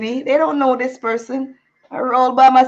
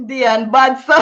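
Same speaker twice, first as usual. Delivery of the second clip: breaking into laughter mid-word; laughing; 6 semitones higher, slower than usual. Real, not acted. A woman laughing through her own painful story. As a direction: laugh it out, half crying.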